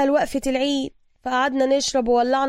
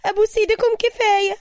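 high, loud-ish hysterical plea; hysterical scream; 8 semitones higher, 3 dB louder than usual. A narrator's reading. Ibtisam begs the fighters to stop, high hysterical cry. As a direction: shriek at them to stop. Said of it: "صرخت ابتسام بهستيريا".

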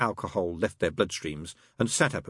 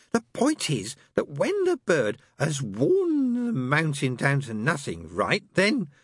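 higher, slow, lightly voiced insistence; pressing demon; 9 semitones higher, slower than usual. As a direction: drive the point home, tipsy. Crowley pressing the point.

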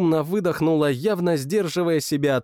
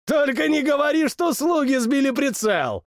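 high, teasing royal jibe; mocking king; 12 semitones higher than usual. King mocks his cousin's misses, high and teasing. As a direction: needle him with glee.

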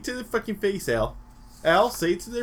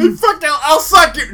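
much higher, louder yell; 11 semitones higher, 12 dB louder than usual. Real, not acted. Friend yells a crude reply. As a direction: shout it crudely. Playful yelling.

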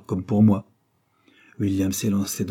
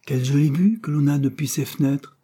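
higher-pitched admiring exclamation; delighted exclamation; 7 semitones higher, 4 dB louder than usual. A narrator's reading. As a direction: exclaim with pleasure at the view. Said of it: "s'écria la vieille dame".

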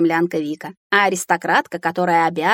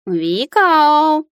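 высокий протяжный кошачий рёв; позови хозяйку истошным мявом; ревущий кот; 8 semitones higher, 5 dB louder than usual. A narrator's reading. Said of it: "взревел Филя". (взреветь котом, протяжно и высоко)

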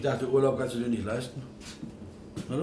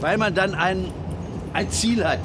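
emphatic; 6 semitones higher, 7 dB louder than usual. Acted. Passionate defence of dreams.

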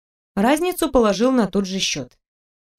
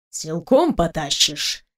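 slow, lower snide question; caustic, drawled challenge; sarcastic; 4 semitones lower, slower than usual. A narrator's reading. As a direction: needle him, drawing it out. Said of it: "язвительным тоном спросил Игнат".

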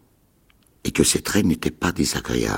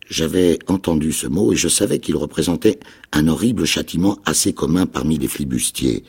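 usual speaking voice

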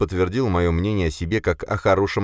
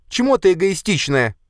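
high, loud, accusing cry; heated, high-voiced outburst; shouted reproach; 5 dB louder than usual. A narrator's reading. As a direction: fling it at your brother. Katya keeps shouting at brother.

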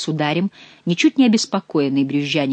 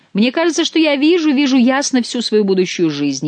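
high, loud rapturous dreaming; rapturous vision; 9 semitones higher, 6 dB louder than usual. A narrator's reading. King telling his beloved his future, rapturous and loud. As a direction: soar up into the vision.

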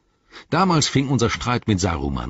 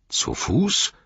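much higher, incredulous question; surprised exclamation; astonished cry; 9 semitones higher than usual. A narrator's reading. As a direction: shoot up in disbelief. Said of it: "rief Eomer".

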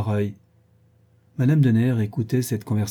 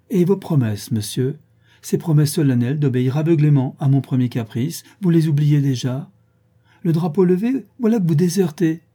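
higher, louder, lively scolding; mock-indignant reproach; 5 semitones higher, 5 dB louder than usual. A narrator's reading. Madame reproaches Léon for deserting, mock-indignant. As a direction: take him to task for broken promises. Lady reproaches him for backing out.

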